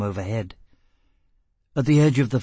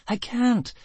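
Gisbella's high plea; 11 semitones higher than usual. A narrator's reading. Gisbella exhausted.

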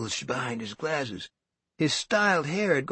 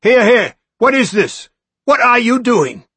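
high, loud, bustling; a loud, fussy manager's demand; officious demand; 11 semitones higher, 10 dB louder than usual. Acted. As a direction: break up the fight. Manager intervenes loudly.

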